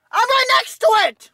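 ranting. Real, not acted. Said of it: "But I'm gonna rant".

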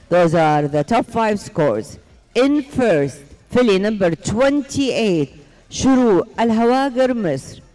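slow, drawn-out arena announcement; booming prize announcer. Acted. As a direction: call out the winners to the arena. Announces horse show winners.

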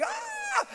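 sudden high exultant cry; high joyful shout. Retold or imitated. Preacher crying out in joy at the end of his prayer. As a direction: let out a sharp high "ah".

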